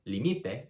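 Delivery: límite stressed as li-MI-te; middle-syllable stress. Shown as a demonstration. Teacher contrasting three forms, límite as li-MI-te. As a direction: put the weight on MI in límite. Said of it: "which all mean different things".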